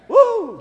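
sudden high, loud "woo"; exultant whoop. Real, not acted. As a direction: let out a loud pulpit whoop. Preacher whoops after joking.

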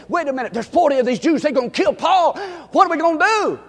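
alarmed. Acted. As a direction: blurt it out in a panic. Nephew's alarmed report voiced.

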